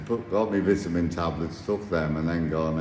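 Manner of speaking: ordinary talk, nothing marked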